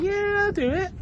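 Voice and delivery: lightly voiced helpful guard; kind guard. Guard agreeing to fetch the headrest, high and kind. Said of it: "the guard was like".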